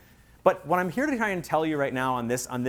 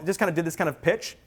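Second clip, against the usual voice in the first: ordinary talk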